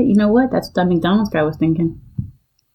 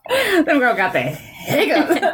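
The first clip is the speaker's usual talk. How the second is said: laughing; 7 semitones higher, slower than usual. Real, not acted. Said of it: "laughing at herself".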